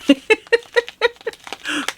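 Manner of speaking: high, giggly burst of laughter; self-amused laugh. Real, not acted. Laughs at reading French off package.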